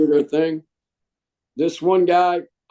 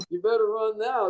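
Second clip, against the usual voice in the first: said through a laugh; amused laughing jab; 5 semitones higher, 6 dB quieter than usual. Real, not acted. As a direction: chuckle the words out. Laughs at the quitting recruits.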